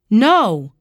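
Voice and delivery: loud, alarmed cry; cried out. A narrator's reading. Uncle refuses to confess, loud cry. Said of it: "he cried".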